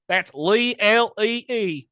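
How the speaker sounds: caller spelling name. Acted.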